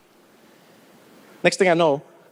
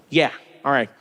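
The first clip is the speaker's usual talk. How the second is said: lower, offhand man-to-man voice; apologetic dad; 7 semitones lower than usual. Acted. Dad to man in cinema, low casual apology. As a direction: drop the pitch and smooth it over casually.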